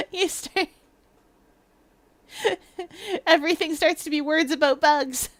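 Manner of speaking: giggles breaking into the words; giggly. Real, not acted. Delivering own bad joke about bugs, giggly. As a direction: push to the punchline through giggles. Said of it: "I'm so giggly tonight".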